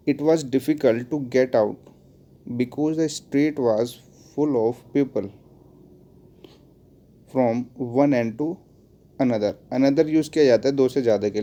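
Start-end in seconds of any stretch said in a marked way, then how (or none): 0.1-9.5 very slow, spaced reading; slow dictation. Shown as a demonstration. Teacher dictating the sentence to students, very slowly. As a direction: pause between chunks so they can write. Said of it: "teacher dictates example sentence".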